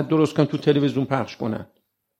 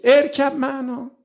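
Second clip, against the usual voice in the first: slow, higher call of a pleading father; Noah calling his son; 10 semitones higher, slower than usual. Acted. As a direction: draw out the call to the son gently. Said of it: "به آقا پسرش میگه".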